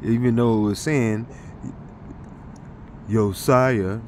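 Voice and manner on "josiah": ordinary talk, nothing marked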